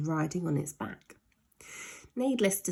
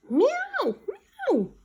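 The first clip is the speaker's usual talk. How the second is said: very high, loud meows; cat meows; 4 dB louder than usual. Acted. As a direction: yowl like squashed cats. Cats squashed.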